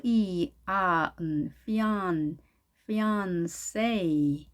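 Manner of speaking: "피안세" built slowly from f, ee, ah, n; sound-by-sound demo. Shown as a demonstration. Teacher assembling fiancé for learners, slow sound-by-sound "피안세". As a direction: stretch "피안세" piece by piece, ending in 'say'. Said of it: "N으로 합니다".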